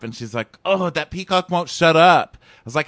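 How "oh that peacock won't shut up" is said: louder, higher mock exasperation; exasperated complaint. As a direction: imitate her loud, drawn-out griping. Retold exasperated complaint about noise.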